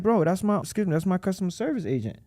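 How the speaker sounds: the owner explaining to a confused caller; exasperated reply. Retold or imitated. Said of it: "I'm like".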